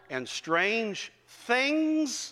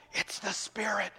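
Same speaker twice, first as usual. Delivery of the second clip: hushed whisper of a wife leaning in; soft whispered aside to a husband on the phone; 2 semitones higher, 5 dB quieter than usual. Retold or imitated. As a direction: breathe it with no voice, just air. Wife whispering beside him.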